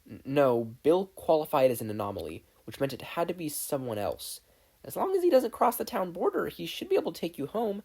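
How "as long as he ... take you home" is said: other speaker voiced. A narrator's reading. Unknown voice on phone.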